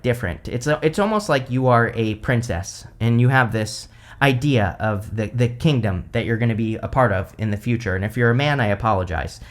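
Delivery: normal talking voice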